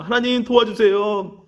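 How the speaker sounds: slow, imploring prayer, retold; pleading prayer. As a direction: act out a heartfelt cry for help. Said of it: "하나님께 기도하는데요".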